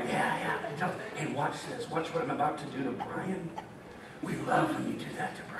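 a sly secret among friends; conspiratorial whisper. Retold or imitated. Preacher voicing God whispering to the seraphim about a prank, hushed and low.

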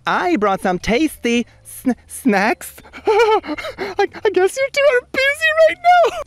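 very high, slow embarrassed squeak; high squeaky voice. Pretend. Acted character line, very high.

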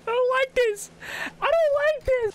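very high, frightened whine; scared whine. Real, not acted. Gamer whining in fear at the creepy scene. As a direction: whimper it in fear.

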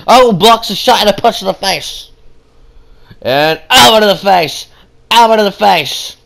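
play-by-play. Acted. Calls moves.

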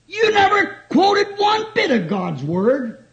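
high, slow, emphatic scolding; retold rebuke. Old pastor correcting young Billy's first sermon, scolding. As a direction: act out an old pastor's drawn-out reproof.

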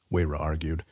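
usual speaking voice

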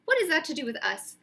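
high, loud scornful anger; angry retort. Acted. Bane angrily mocking Ronan's excuse, loud scorn. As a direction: throw his words back in scorn. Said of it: "kicked back his legs in anger".

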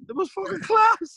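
high, slow and loud, savouring class; relishing the fancy treatment; delighted. Real, not acted. Telling the interviewers, thrilled and drawn out. Proud boast about being called Mister.

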